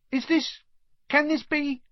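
very high, halting wonder; awed disbelief. A narrator's reading. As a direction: falter upward in astonishment. Barman recognises Harry in disbelief.

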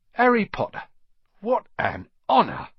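high, loud exclamation of honour; thrilled old barman; overawed delight. A narrator's reading. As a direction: exclaim it as if meeting your hero. Barman overjoyed to meet Harry.

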